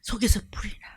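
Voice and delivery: hushed whisper of a burning heart; urgent whispered confession. Retold or imitated. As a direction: breathe it out as a secret. Prophet confesses inner fire.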